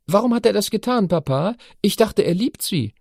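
put-on high, curious girl's voice; teenage daughter. A narrator's reading. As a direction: ask like a puzzled teen daughter. Narrator voices Chiara.

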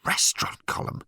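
hushed, baffled echo; bewildered, not yet angry. A narrator's reading. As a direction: repeat it in stunned disbelief. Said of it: "too bewildered to be really angry".

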